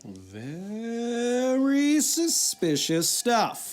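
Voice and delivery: very slow, mock-spooky drawl; ominous echo. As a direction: linger on every word like a creepy narrator. Host echoes the ominous line.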